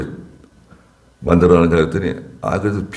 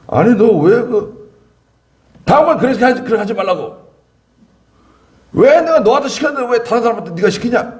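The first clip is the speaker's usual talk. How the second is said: hammed-up pastor telling off a helper; imitated scolding pastor; 14 semitones higher than usual. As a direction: pastor scolds the speaker in front of everyone, high mock rebuke. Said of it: "소리를 그냥 지르고 막 그냥 뭐라 그러고".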